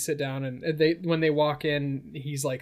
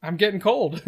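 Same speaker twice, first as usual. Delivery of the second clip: higher, louder whiny cup voice; a needy mug complaining to the doctor, higher and louder; coffee cup voice; 5 semitones higher, 8 dB louder than usual. Acted. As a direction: play a sulking talking coffee cup.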